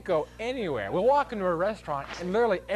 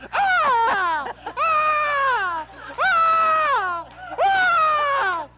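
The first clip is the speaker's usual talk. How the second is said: high, loud mock toddler shriek; screaming back; 10 dB louder, much slower than usual. Screaming at the kid in the restaurant, mock shriek. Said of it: "I went".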